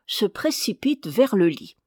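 plain reading voice

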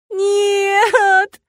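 high, loud, long wail; stretched-out wailing cry; howling. A narrator's reading. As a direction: howl the word in misery. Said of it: "провыла я".

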